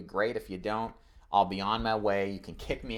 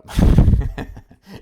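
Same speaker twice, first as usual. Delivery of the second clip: laugh; 3 semitones higher, 13 dB louder than usual. Real, not acted. Laughs at own weird demo.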